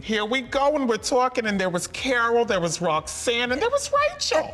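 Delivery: high, giddy rush of names; excited. Retold or imitated. Gleeful recap of Jersey challenge.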